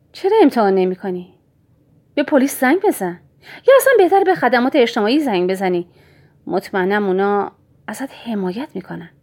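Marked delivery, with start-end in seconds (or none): 0.1-5.9 1 mocking taunt. Villain taunts the mother.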